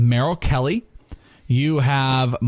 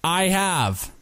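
building intensity; 6 semitones higher than usual. Pretend. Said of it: "I was trying to build up the intensity there".

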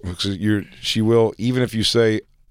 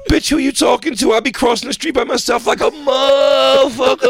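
very high, loud cartoon squeal; squeaky wannabe-tough kid voice; mock street-kid hype man; 4 dB louder than usual. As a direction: brag in a hammed-up falsetto shout. Both play cartoonish street-kid character.

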